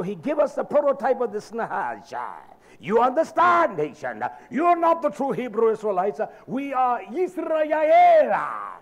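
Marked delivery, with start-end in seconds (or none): 2.8-8.7 a loud declaration that slows right down and ends in a shout on Yisrael; preacher's proclamation. Real, not acted. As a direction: claim the name, slow and thundering.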